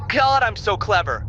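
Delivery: ordinary talk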